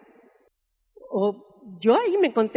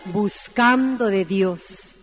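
slow emphatic; 5 semitones lower, much slower than usual. Acted. Answers her own question.

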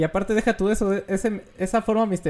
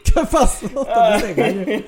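laughing; 5 semitones higher, 5 dB louder, slower than usual. Real, not acted. Laughing at friend's voice crack.

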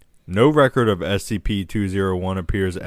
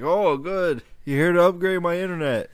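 put-on cheerful old customer voice; 8 semitones higher than usual.

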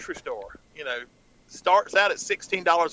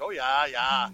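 mock Sarah Palin accent; 4 semitones lower, slower than usual. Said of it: "Just like Sarah Palin".

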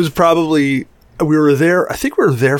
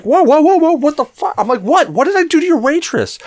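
mock startled protest while being hit; shocked. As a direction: recoil in disbelief. Speaker shocked while being punched.

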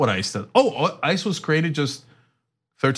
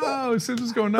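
high, lightly voiced gasp of wonder; imitating people impressed by him; mock amazed onlooker; 9 semitones higher than usual. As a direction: play a stunned bystander. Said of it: "And people were like".

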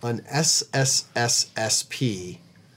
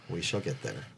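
softer, grand 'We shall get there'; mock-solemn hush; 8 semitones lower, 10 dB quieter than usual. Shown as a demonstration. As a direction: drop to a quiet solemn 'shall'. Repeats the promise, mock-solemn close.

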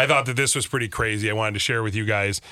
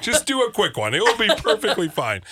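higher-pitched breezy pleading; mock-casual coaxing, pitched up; imagined patient calling doctor; 9 semitones higher than usual. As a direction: play a patient blithely talking a doctor round. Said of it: "Des calling her doctor".

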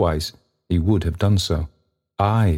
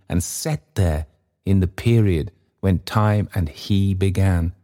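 usual speaking voice

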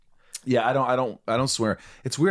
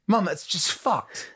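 put-on higher, drawn-out English accent; mock English accent; 8 semitones higher, slower than usual. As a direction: play an English kid complaining to Mum. Acts out swearing at her mother.

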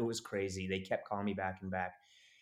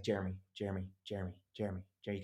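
softer repeated name, trying to cut in; repeated interrupting; 2 semitones lower, 6 dB quieter, much faster than usual. Retold or imitated. Manager trying to stop the crying actor's rambling, interrupting. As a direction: keep patiently repeating the name. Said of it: "He just keeps saying".

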